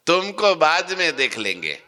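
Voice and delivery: high, gloating mock threat; menacing threat. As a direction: sneer it like a greedy conjurer. Madari repeats threat, higher.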